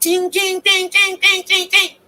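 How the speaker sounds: high sing-song ching ching; cash register ching. Pretend. Bragging about her money, high sing-song chant. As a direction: chant it like a ringing till.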